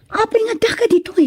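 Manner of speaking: breathless hurried whisper; secret whisper. Acted. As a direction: whisper fast so no one hears. Lovers hiding, whispering secretly. (pabulong na lihim na pagsasalita)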